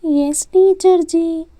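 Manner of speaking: put-on high, slow childish reply; child pupil. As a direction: answer the teacher like a little girl. Pupil answers teacher.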